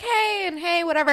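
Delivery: squeaky high hey; high mock greeting. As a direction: pitch it way up, breezy. [name] greets Becky.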